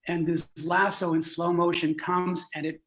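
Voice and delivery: plain reading voice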